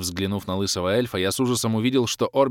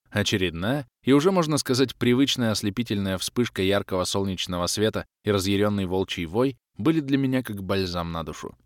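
ordinary delivery

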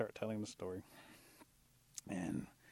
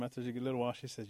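usual speaking voice

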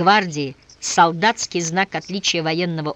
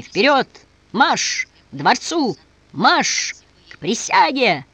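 loud, high barked orders; shouted commands; 5 semitones higher, 3 dB louder, much slower than usual. Acted. General commands the troops to march. (громкие командные выкрики)

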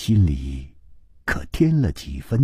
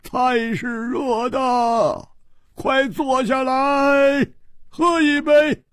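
high, drawn-out call to come and sit; hearty host calling out; 11 semitones higher, slower than usual. Acted. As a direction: call the guests to table in long, ringing high notes. King warmly calls guests to sit.

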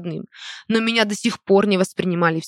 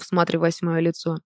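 usual speaking voice

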